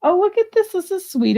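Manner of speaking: light up at the find; excited. Real, not acted. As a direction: pitch jumps way up in delight.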